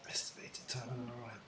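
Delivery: whispered softly to herself in wonder; awed whisper. Real, not acted. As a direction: breathe the phrase out, no voice. Repeats it in awe.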